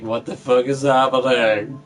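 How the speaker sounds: overwhelmed disbelief. Retold or imitated. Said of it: "I'm just like".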